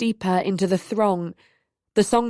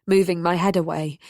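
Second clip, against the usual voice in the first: ordinary delivery, nothing marked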